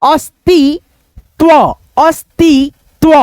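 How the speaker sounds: plain त without a puff in अस्तित्व, in separate beats; unaspirated t. Shown as a demonstration. Teacher answering how to read त, अस्तित्व in slow chunks. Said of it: "इसको हम कैसे पढ़ेंगे".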